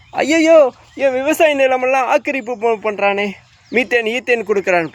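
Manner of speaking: high mocking wail that settles toward the end; mock farmer's lament. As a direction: mimic a farmer crying over his land. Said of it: "புலம்புறீங்களா?". (விவசாயியின் புலம்பலைக் கேலியாகப் பின்பற்றும் உயர்ந்த குரல்)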